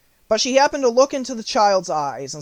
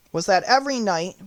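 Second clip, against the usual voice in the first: ordinary delivery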